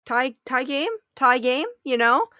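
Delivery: spelling out the joke. Acted. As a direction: nudge him toward the pun.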